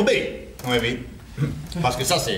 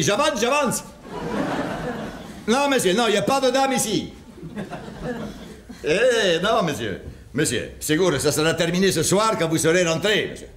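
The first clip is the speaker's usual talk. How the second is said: put-on Spanish accent. Husband fooling the jealous caller in a put-on Spanish accent. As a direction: play the Spanish plumber on the phone with a Spanish accent. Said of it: "Pourquoi tu as pris cet accent stupide".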